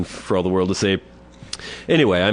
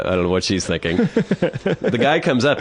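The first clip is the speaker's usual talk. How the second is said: ordinary delivery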